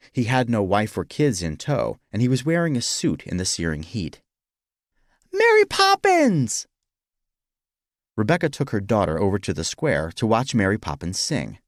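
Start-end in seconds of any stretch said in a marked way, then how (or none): 5.3-6.7 very high, loud, stretched-out cry; thrilled, sky-high shout; excited child's squeal. Acted. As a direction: squeal with delight like a little kid. Excited child spots Mary Poppins.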